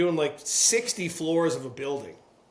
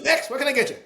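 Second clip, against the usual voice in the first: high-pitched imitation of a busy New York counterman; hurried deli counterman; 8 semitones higher, 4 dB louder, faster than usual. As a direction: call out to the next customer. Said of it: "it's lunchtime, so they're in a hurry".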